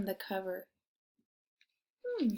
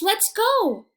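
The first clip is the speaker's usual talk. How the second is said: high, rallying shout; 10 semitones higher, 11 dB louder than usual. Acted. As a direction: call the group to set off. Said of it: "he shouts".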